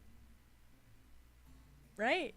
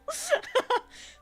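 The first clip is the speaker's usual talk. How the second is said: real laugh; 5 dB louder than usual. Real, not acted. Reacting to the alien laugh.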